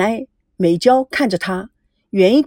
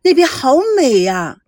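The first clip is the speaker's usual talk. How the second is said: higher, excited call to the driver; higher excited shout to stop the car; sudden call; 6 semitones higher, 4 dB louder than usual. Acted. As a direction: call out, delighted by the view. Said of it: "孙娜突然地喊道".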